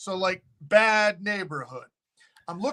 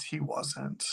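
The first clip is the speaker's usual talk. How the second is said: much softer, whispered; whispered aside; 2 semitones lower, 10 dB quieter than usual. Real, not acted. Host debunking the owner, whispered aside. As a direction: drop it to a whisper. Aside about fake descendant.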